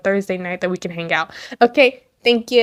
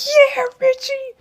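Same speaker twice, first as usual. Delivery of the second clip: high cooing quote, much higher pitch; mock swooning guys. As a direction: coo it like a starstruck fan. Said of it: "being like".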